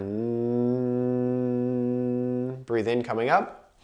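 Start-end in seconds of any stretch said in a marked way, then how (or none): 0.0-2.6 long steady hum, tongue pressed to the roof of the mouth; hummed N sound. Shown as a demonstration. Breathing teacher shows the class the N exhale, long steady hum. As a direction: let the breath out on one even hum. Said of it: "sounds like a lawnmower".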